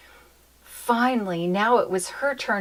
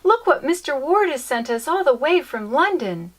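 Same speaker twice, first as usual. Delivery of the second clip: higher, louder eager telling; excited; 7 semitones higher, 5 dB louder than usual. Acted. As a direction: show it off with glee. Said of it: "cried Fernie".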